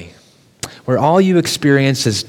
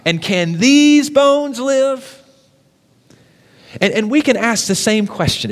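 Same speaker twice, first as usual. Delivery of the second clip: higher, pleading sermon voice voicing a struggling believer; impassioned preaching; 7 semitones higher than usual. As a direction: preach it, rising, as the broken person's prayer. Preaching voicing a desperate prayer.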